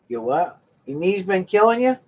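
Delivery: ordinary talk, nothing marked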